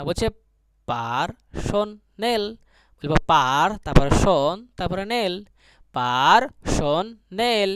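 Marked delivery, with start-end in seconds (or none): 0.9-2.4 পারসোনেল very slowly in three separate pieces, par, shon, nel; syllable-by-syllable. Shown as a demonstration. Teacher dictating to students, পারসোনেল split into slow syllables. Breaking word into syllables for class. 6.0-7.7 পারসোনেল very slowly in three separate pieces, par, shon, nel; syllable-by-syllable. Shown as a demonstration. Teacher dictating to students, পারসোনেল split into slow syllables. Breaking word into syllables for class.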